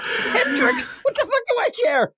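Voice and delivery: high-pitched laughing mock rant. Real, not acted. Podcaster cracking up at the no-luck joke, laughing. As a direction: crack up while mocking the line. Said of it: "I started laughing".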